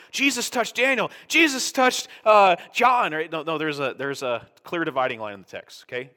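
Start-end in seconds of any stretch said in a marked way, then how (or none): 0.1-3.1 higher, louder put-on voice of a muddled reader; hammed-up voice of a confused Bible reader; mock confused reader. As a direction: play the eager reader who mixes it all up. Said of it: "you're like".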